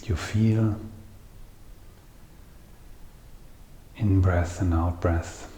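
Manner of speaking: soft, unhurried meditation voice with long pauses; guided meditation. Acted. Teacher guiding listeners to feel the breath, soft meditative voice. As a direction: guide them gently into the breath, leaving long silences.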